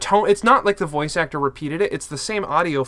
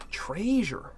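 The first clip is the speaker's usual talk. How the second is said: whispered; 4 semitones higher, 6 dB quieter than usual. Real, not acted. Whispers the found treasure.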